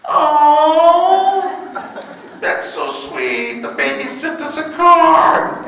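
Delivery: high swooping coo of delight; gushing women. Retold or imitated. Women reacting to a baby's card, cooing sweetly. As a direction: coo over it like it's adorable. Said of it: "Women go".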